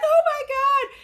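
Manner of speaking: very high mock-tearful fangirl squeal; hammed-up overwhelmed squeal, imitating a starstruck mother; imitated crying mom. Mom seeing her idol, imitated tearful squeal. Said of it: "she started crying, and she was like".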